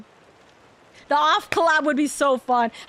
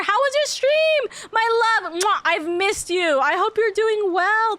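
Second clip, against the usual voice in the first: sweet greeting; 7 semitones higher, slower than usual. Real, not acted. Greeting the raider affectionately.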